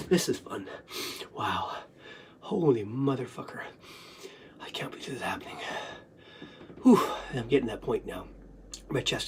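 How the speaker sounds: very soft, low whisper; stunned whisper. Real, not acted. Speaker can't believe this is happening, stunned whisper. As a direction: whisper it, spent and disbelieving. Spent, stunned aside after sobbing.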